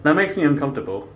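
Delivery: ordinary talk, nothing marked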